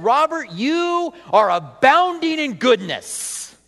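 slow, high, loud gushing; grandiose mock praise. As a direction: proclaim it with hammed-up grandeur. Mock praise nobody has ever given him.

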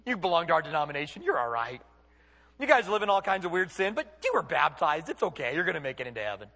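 lightly voiced complacent pastor, lulling his flock; mock soothing pastor. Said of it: "When he tells people".

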